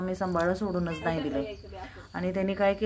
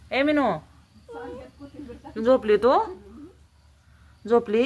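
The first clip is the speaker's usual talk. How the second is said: raised voice, loud pet-talk questions; calling out; 5 semitones higher, 4 dB louder than usual. Real, not acted. Owner asks Minu if she is asleep, calling out. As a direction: call up to a dozing cat.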